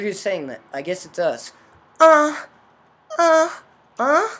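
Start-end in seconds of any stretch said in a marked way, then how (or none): 2.0-4.3 confused. Acted. Character dizzy, confused.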